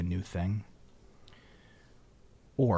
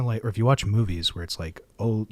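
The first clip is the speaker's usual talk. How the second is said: ordinary talk, nothing marked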